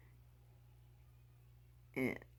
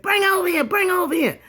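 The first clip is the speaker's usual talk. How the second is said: much higher, loud urgent call; commanding grandma; 9 dB louder than usual. Retold or imitated. Big Mama calling to bring the girl to the trough. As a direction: order the kids over urgently. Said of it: "she said".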